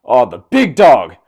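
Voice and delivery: slow, booming imitation of a wrestling announcer; mock commentator shout. Vlogger mocking the commentator on Roman Reigns' entrance, mock shout. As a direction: holler it like a hyped-up ringside commentator. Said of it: "Michael Cole shouting".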